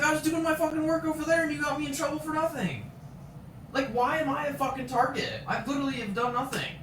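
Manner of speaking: very high, strained retold protest; aggrieved outburst. Acts out furious inner protest.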